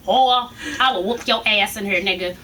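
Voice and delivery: usual speaking voice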